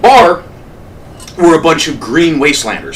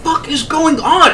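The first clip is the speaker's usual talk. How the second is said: hushed bewilderment; 9 semitones higher, 6 dB quieter than usual. Retold or imitated. Bystander baffled by repeated screaming, high hushed disbelief. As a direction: stretch it out in a high, baffled hush.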